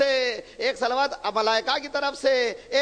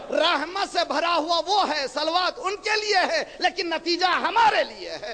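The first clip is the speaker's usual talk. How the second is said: high, emphatic sermon climax; impassioned preaching; 7 semitones higher than usual. Real, not acted. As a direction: drive it up into a call for blessings. Preaching climax and call for salawat.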